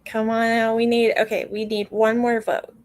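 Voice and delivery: normal talking voice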